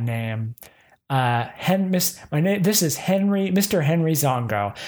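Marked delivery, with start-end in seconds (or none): none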